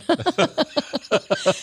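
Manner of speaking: a short, high giggle at herself; airy giggle at her own expense; light laugh. Real, not acted. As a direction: laugh it off, amused at yourself.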